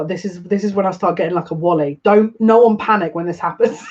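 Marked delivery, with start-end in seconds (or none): 2.0-3.8 louder, choked-up cry of joy; overwhelmed cry. Real, not acted. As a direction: cry out through happy tears. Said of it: "I'm speaking to myself".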